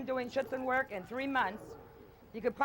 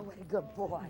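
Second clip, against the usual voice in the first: ordinary delivery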